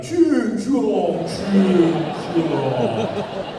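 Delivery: loud slow-motion taunt, drawn-out vowels. Retold or imitated. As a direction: stretch the shout as if time slowed. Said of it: "time kinda slowed down".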